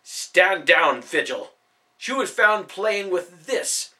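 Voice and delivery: stern order. A narrator's reading. Brutus orders the father back.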